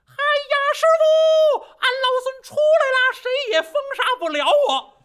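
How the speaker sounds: high, loud monkey yell bursting out; triumphant monkey shout. A narrator's reading. Monkey bursting out of the mountain, shouting to his master in triumph. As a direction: roar out free and gleeful. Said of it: "嗷嗷这么一嗓子".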